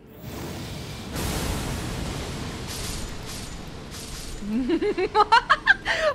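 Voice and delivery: long high gleeful cackle; triumphant laughter. Real, not acted. Wipes out all the enemies.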